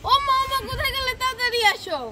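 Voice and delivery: high, loud, urgent call; calling for mother. Acted. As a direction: shout for mother to come quickly. Child calls for absent mother.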